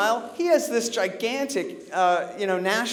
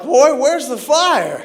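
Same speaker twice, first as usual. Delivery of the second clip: higher, louder, slow Southern cop drawl; 7 semitones higher, 8 dB louder, slower than usual. Retold or imitated. Cop at the window with a slow drawl. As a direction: play a small-town cop pulling him over. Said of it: "Cop's like".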